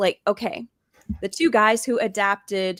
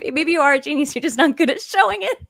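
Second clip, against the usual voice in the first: higher, louder, laughing through the words; amused, laughing tease at a raised pitch; 7 semitones higher, 4 dB louder, faster than usual. Real, not acted. Joking rephrase while laughing.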